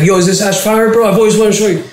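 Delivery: high, excited fan voice; a fan gushing over his own hash; eager fan. Retold or imitated. Fan shows Cuban his hash, high and excited. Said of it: "this dude was like".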